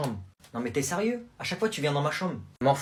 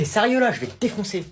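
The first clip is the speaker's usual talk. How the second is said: loud, high, furious comeback; angry threat; 7 semitones higher, 7 dB louder than usual. Acted. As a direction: snap and threaten the kid. Cousin threatens kid.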